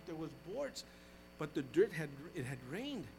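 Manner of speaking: ordinary talk, nothing marked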